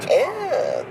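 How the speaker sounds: high grossed-out ew; disgusted ew. Real, not acted. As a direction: recoil at the weather. Reacting to ugly weather.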